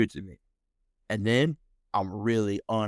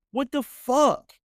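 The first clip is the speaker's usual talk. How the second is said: high, slow, louder what the fuck; high indignant exclamation; disbelieving outburst; 13 semitones higher, 4 dB louder, slower than usual. Real, not acted. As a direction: exclaim in stunned outrage. Outraged exclamation.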